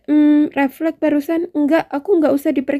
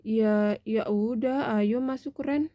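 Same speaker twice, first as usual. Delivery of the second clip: much softer, subdued reply; reluctant assent; 3 semitones lower, 10 dB quieter, slower than usual. Acted. Wife yields to Randy's call inside, reluctant and quiet. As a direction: give in quietly.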